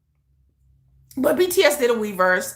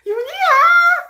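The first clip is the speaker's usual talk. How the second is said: very high, loud squeal of his name; breathless fangirl cry, much higher and louder; swooning squeal; 7 dB louder, slower than usual. Retold or imitated. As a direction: squeal like you're about to faint. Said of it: "I did pass out".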